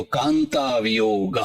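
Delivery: Kanta lifted high and drawn out slowly, then વિયોગ; slow emphatic recitation of the first word; stressed high on Kanta. Shown as a demonstration. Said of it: "સૌથી પહેલો શબ્દ લખી દીધો".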